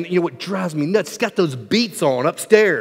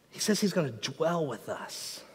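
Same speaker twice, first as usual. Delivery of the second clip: normal talking voice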